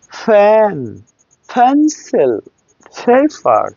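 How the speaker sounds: "ফ্যান, পেন্সিল, পেপার", each p with a strong puff; high, separated "ফ্যান, পেন্সিল, পেপার" with airy p; aspirated English P. Shown as a demonstration. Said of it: "পি দিয়ে না".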